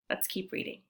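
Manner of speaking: whisper. Real, not acted. Teacher tells the class to keep reading, whispering.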